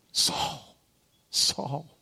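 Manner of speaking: hushed, breathy calling of the name; pleading whisper. Retold or imitated. Preacher voicing Jesus calling Saul, soft pleading whisper. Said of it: "Can you hear the pleading love".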